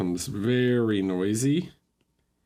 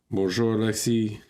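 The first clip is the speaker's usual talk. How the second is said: mock French accent. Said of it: "I'm super good at French accent".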